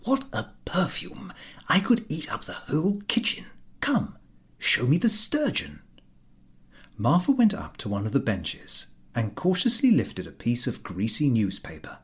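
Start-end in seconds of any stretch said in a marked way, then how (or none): 0.0-5.8 high, whispery, lip-smacking glee; eager host drooling over the fish; greedy host. A narrator's reading. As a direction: savour every word like a glutton. Ahiniv's voice, greedy delight.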